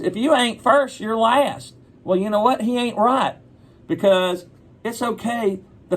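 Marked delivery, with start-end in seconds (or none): 0.0-1.7 high-pitched movie-quote voice; Ricky Bobby. Acted. Quoting a film character to kids, high character voice. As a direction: deliver a catchphrase, pitched well up. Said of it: "if you know Ricky Bobby, he goes".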